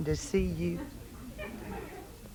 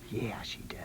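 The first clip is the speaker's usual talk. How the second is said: whisper; 9 semitones lower, 12 dB quieter than usual. Real, not acted. Host answering for a shy girl, whispered. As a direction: breathe it softly, no voice. Soft aside to little girl.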